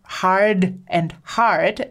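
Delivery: "hard and hard" said slowly and carefully; slow contrast. Shown as a demonstration. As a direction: linger on each "hard" in "hard and hard". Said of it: "if you just say that out loud".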